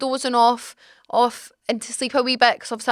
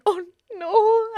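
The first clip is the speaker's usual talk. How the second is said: imitating crying mum; 9 semitones higher than usual. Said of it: "My mum's in the corner crying".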